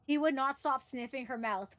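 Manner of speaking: usual speaking voice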